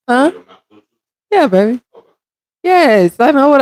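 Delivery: usual speaking voice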